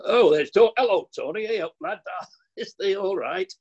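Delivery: high, hammed-up Yorkshire greeting; mock Yorkshire, high and eager to convince; put-on Yorkshire accent. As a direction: overdo the Yorkshireman, pitched right up. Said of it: "convince him that I really was from Yorkshire".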